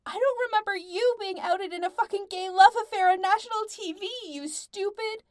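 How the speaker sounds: shrill, loud, outraged tirade; high and shrill. A narrator's reading. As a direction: shriek the accusation until cut off. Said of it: "voice sounded high and shrill".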